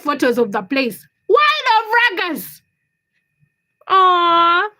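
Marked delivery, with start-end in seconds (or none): 1.3-2.6 high, drawn-out outburst; loud exclamation. Real, not acted. Reacting loudly to chat. 3.9-4.7 loud, high cooing aww; long melting coo; touched "aww". Real, not acted. Touched by a chat message.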